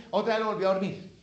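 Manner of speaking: ordinary talk, nothing marked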